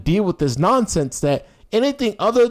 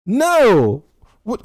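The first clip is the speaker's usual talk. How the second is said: ordinary talk, nothing marked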